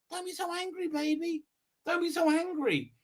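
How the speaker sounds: high, soft coaxing; sugary pretend comforting; mock soothing. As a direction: coo it softly, as if calming a baby. Soothing mock plea to angry character.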